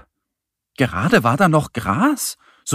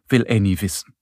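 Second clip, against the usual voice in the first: usual speaking voice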